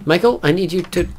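usual speaking voice

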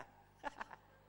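breathy high giggle; airy giggle. Real, not acted. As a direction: let out a whispered laugh. Laughs at the absurd question.

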